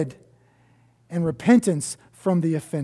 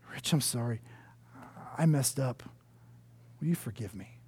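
humble soft apology; 7 semitones lower, 13 dB quieter than usual. Retold or imitated. Offender's humble apology voiced.

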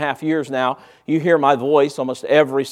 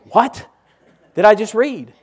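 high, louder "What did I just read?" in mock bewilderment; baffled; 7 semitones higher, 6 dB louder than usual. Reader baffled after reading page.

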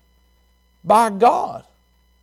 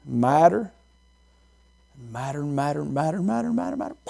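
madder repeated ever softer; fading repetition; 5 semitones lower, 7 dB quieter than usual. Shown as a demonstration. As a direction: let madder fade away. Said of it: "you get a little madder".

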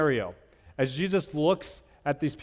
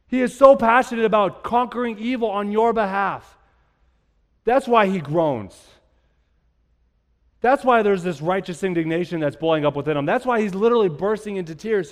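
fervent pulpit delivery; preaching; 4 semitones higher, 5 dB louder than usual. Acted. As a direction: keep preaching with conviction. Continuing the sermon build.

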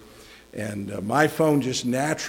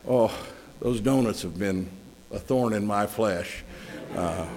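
ordinary talk, nothing marked

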